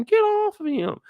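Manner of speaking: second frantic yell, same pitch; high panicked Ellie. Retold or imitated. Repeated panic line.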